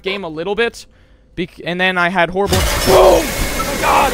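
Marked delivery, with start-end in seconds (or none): 2.6-4.1 high, loud, drawn-out cry; jump-scare scream. Real, not acted. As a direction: scream out mid-sentence in shock. Jump scare interrupts talk.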